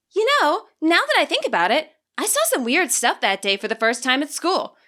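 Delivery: put-on high, lively girl voice with a big announcer line; Nimona, storytelling. Nimona recounting her tour to Juliana, lively and high. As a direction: bounce up high, ham up the classic tour line.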